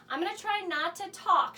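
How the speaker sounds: normal talking voice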